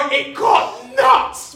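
high, loud, drawn-out exclamation; storyteller hitting the crazy peak; wildly worked up. Real, not acted. Climax of his robbery story.

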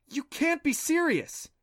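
high, slow, incredulous cry; a girl who can't believe her ears; stunned disbelief. A narrator's reading. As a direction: pitch it way up in shock, drawn out. Clancy stunned by Adam's claim.

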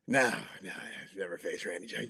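meek reply. Retold or imitated. Reporter answering the irritated star player, meek and quiet. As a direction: shrink back and answer quietly.

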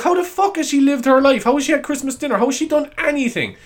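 high, loud mock disbelief; hammed-up incredulous outburst. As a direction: fire off baffled questions at high pitch. Retelling workers' disbelief at allergy.

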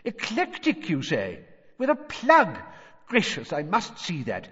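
high-pitched, eager wonder; excited. A narrator's reading. Mister Weasley fascinated by a plug, eager and high. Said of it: "said Mister Weasley's voice excitedly".

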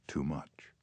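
"Too much" breathed as a soft unvoiced whisper. A narrator's reading. Father kneeling at his sleeping son's bedside, whispered. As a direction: whisper it to yourself in shame.

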